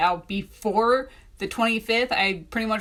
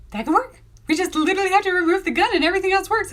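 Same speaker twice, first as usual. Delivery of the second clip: high, loud, rapid rush of relief; excited realisation; 9 semitones higher, 5 dB louder, faster than usual. Retold or imitated. Author retelling the cover breakthrough, excited. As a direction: blurt the fix out fast and high.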